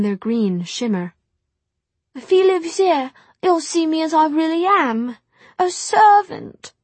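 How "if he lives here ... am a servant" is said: high, loud tearful voice breaking; fighting back tears, voice cracks; voice cracking. Acted. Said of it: "Her voice cracked".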